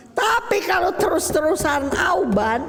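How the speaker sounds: high-pitched exasperated outburst. Shown as a demonstration. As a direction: pitch jumps up as patience runs out. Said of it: "kita ngomongnya nada tinggi".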